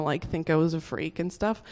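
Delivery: normal talking voice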